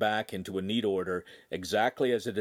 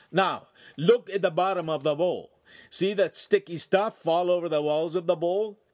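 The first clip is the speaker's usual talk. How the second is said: Italian master; 8 semitones higher, 5 dB louder than usual. Acted. Master instructing, Italian accent.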